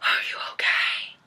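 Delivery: hushed breathy whisper. Retold or imitated. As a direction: whisper it tenderly. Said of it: "touching her face and stuff like".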